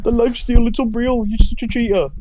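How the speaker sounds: high, loud imitation of a sore loser; mock outraged complaint. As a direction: whine it high, mimicking an indignant opponent. Mimicking opponents' outraged complaints.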